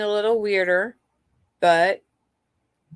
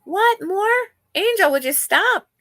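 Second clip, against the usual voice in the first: high, louder scold to a pet; high scolding call; 13 semitones higher, 5 dB louder, slower than usual. Real, not acted. Scolding pet Angel mid-stream.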